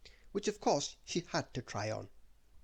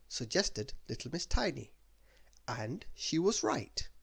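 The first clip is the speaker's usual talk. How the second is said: plain reading voice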